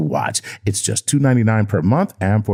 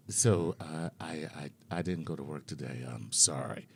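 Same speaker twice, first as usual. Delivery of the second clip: much softer and lower, hesitant apology; sheepish confession; 6 semitones lower, 15 dB quieter than usual. Retold or imitated. Husband softly confessing he skipped work. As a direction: mumble it guiltily. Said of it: "And then it's just like".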